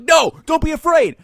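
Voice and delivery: high, loud mock coaching yell; mock mom shout. Mom in the car urging her son not to hold back, mock yell. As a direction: push him on like a pushy coach.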